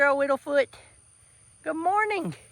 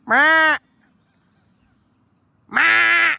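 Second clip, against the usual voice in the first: calling out; 4 semitones higher, 7 dB louder than usual. Retold or imitated. Calling out imitating deer.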